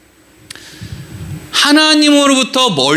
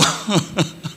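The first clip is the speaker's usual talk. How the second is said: short laugh; 2 semitones lower, 9 dB quieter than usual. Real, not acted. Laughs at own impression.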